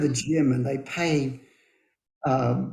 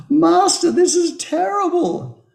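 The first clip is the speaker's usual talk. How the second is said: high, louder anguished farmer; injured farmer wailing, much higher; distressed farmer; 12 semitones higher, 6 dB louder than usual. A narrator's reading. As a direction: cry out to the master in dismay. Parable, farmer complains of leg.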